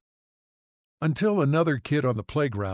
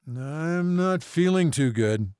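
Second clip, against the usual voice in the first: slow, higher, drawn-out mumble; mumbling; 8 semitones higher, 4 dB louder, slower than usual. A narrator's reading. Injured man to one of the crewmen, slow mumble. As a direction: mumble it slowly, feeling faint. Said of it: "he mumbled to one of the crewmen".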